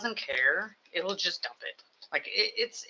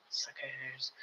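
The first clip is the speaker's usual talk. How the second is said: whispered; 4 semitones lower, 15 dB quieter than usual. Real, not acted. Teasing viewers after joke.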